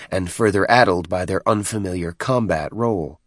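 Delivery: usual speaking voice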